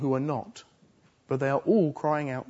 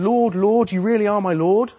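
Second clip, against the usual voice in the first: fervent cry; 5 semitones higher, 7 dB louder than usual. Retold or imitated. False disciples crying out to Jesus.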